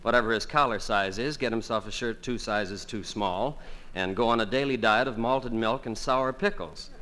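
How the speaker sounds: normal talking voice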